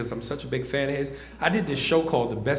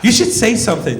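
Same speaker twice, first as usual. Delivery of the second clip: loud, raised-pitch urging; offended friend; 6 semitones higher, 7 dB louder than usual. Retold or imitated. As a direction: indignantly push him to speak up. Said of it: "turn to me offended, like".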